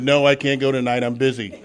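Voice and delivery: retold refusal, said loud and firm; loud brush-off. Refusing the woman's meeting invite, loud and firm. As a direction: shut the invitation down hard.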